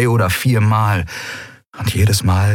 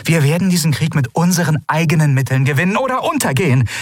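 higher, sharp, indignant refusal; indignant ruler; 8 semitones higher than usual. A narrator's reading. Ruler rebukes the old minister, indignant. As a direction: slap the idea down.

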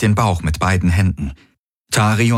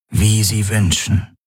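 put-on old doctor; 4 semitones higher, slower than usual. A narrator's reading. Doctor yields.

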